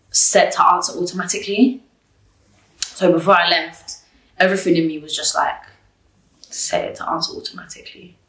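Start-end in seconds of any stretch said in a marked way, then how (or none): 6.4-8.2 hushed, breathless inner voice; a secret nudge, half whispered. Retold or imitated. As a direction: whisper it like a voice inside your head. Inner voice urging her to act.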